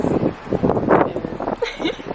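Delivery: laugh. Real, not acted. Laughing with friend.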